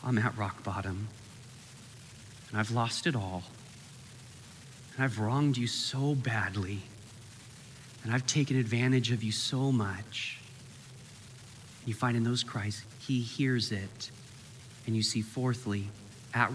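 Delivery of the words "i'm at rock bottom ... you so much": much softer and lower, halting with long pauses; broken prayer. Retold or imitated. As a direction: pray from rock bottom, barely holding together. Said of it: "your voice breaks through".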